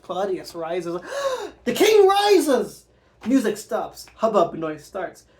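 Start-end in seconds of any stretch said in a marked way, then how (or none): 1.1-1.6 startled gasp, way up high; sharp high gasp. Acted. King rises in shock. 1.6-2.9 much higher, louder, slow alarmed cry; shocked cry. Acted. Shock at the play.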